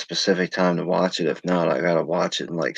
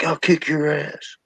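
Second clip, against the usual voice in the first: high, drawn-out heckle from the stands; mock a fan's drawn-out threat; put-on high-pitched heckler taunt; heckling fan; 9 semitones higher, slower than usual. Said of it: "somebody in the stands like".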